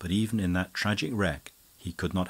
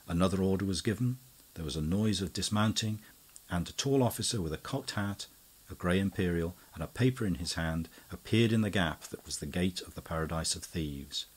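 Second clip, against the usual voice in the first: ordinary delivery, nothing marked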